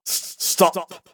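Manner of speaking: savage cry. A narrator's reading. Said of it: "cried the Reverend Mister Anstruther, savagely".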